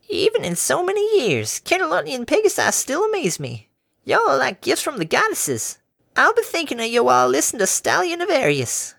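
put-on high, cheery elderly mare; a sweet old farm granny offering water. A narrator's reading. Granny offering water to the workers, high and cheery.

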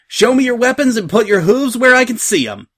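high, loud pirate command; taking charge at full voice; shouted order. A narrator's reading. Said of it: "she shouted".

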